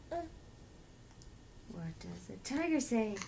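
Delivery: put-on reading to a toddler. Slow quiz to toddler.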